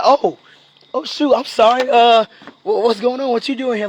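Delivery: flustered, caught out. Acted. Client caught by his trainer at Dunkin' Donuts, flustered. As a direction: caught red-handed, stumbling into an apology.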